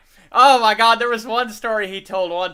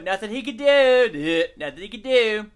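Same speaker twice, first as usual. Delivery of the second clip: ordinary talk, nothing marked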